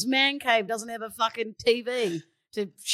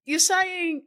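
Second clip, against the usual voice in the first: ordinary delivery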